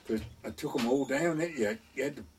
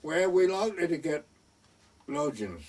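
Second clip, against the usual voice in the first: raised, higher retold shout; shouted through letterbox; 5 semitones higher, 4 dB louder than usual. Young lodger shouting to postmistress behind bolted door, raised shout. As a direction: call loudly through a shut door. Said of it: "So I shouted through the letterbox".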